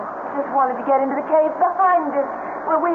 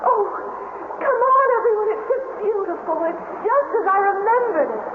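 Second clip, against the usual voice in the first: high, delighted calling; thrilled wonder; 8 semitones higher than usual. Real, not acted. Eve calling everyone into the cave she loved as a child. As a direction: let the awe spill over.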